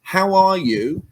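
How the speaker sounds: drawn-out cheery "How are you?" with a rising end; slow, high sing-song caller; mock cold caller. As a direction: ham up a salesy telemarketer greeting.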